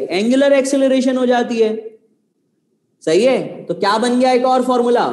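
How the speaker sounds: ordinary delivery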